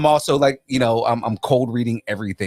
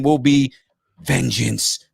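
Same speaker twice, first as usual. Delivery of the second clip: normal talking voice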